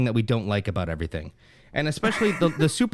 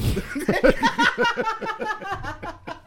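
high-pitched burst of laughter; helpless high laugh. Real, not acted. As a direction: crack up in a long high laugh. Laughs at the accent teasing.

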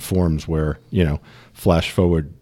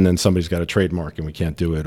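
usual speaking voice

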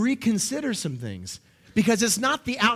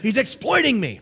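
mock complainer; 5 semitones higher than usual. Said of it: "I hear people say".

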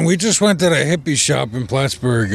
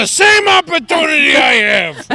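high put-on shout; 9 semitones higher than usual.

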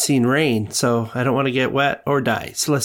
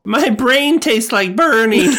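goofy Ralph Wiggum voice; 10 semitones higher, 6 dB louder, slower than usual. Pretend. Player quotes Simpsons line drowsily.

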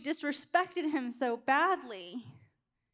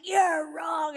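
loud, higher mock yell at a husband; retold yell; 4 semitones higher, 5 dB louder than usual. Wife insisting to her husband that he's wrong, retold yell. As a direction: yell it, imitating your own stubborn outburst. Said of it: "I literally yelled at him".

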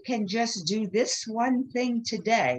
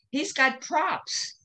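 ordinary delivery

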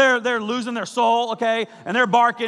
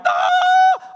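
high giddy squeal of love; lovestruck squeal; 6 dB louder than usual. Retold or imitated. Besotted lover squealing to their crush. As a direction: squeal like you're smitten. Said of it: "you are just like".

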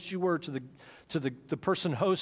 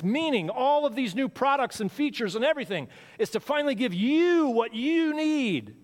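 climbs higher and louder to the peak; preacher pushing hard; rising preaching; 8 semitones higher, 4 dB louder than usual. Real, not acted. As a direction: build into a fervent preaching climax. Preaching builds in force.

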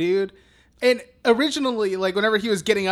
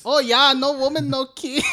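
put-on higher-pitched comic German accent; mock German accent; 6 semitones higher than usual. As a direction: ham up a silly German accent. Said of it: "It sounds like German".

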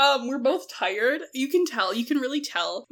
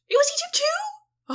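surprised. Real, not acted. Surprise at Egypt's fate.

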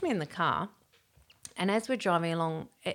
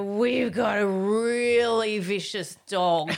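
put-on drunk bluff; 5 dB louder, much slower than usual. Drunk warning to hitchhikers.

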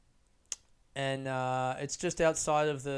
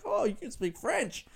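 impressed surprise; 8 semitones higher than usual. Retold or imitated. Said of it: "they're like".

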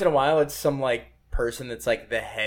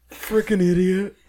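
Napoleon Dynamite impression; 5 semitones higher, slower than usual.

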